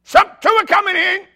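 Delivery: very high, loud staff yell. Retold or imitated. Restaurant staff calling out customers to each other, yelling. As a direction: holler the arrivals out to everyone. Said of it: "They start yelling".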